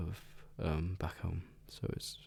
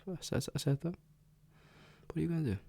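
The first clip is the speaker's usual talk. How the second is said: playful taunt; 10 semitones higher, faster than usual. Real, not acted. Taunting brother watching video.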